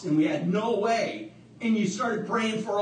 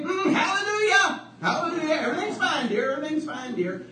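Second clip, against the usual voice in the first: high, elated hallelujah shouts; joyful answer; 9 semitones higher than usual. Retold or imitated. Abram calling up to his wife, elated high shouting. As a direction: answer up full of joy.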